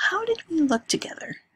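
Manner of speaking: giddy whispered question; eager whisper. Acted. Lena eagerly asks at night.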